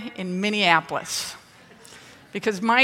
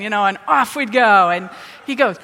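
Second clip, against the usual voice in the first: usual speaking voice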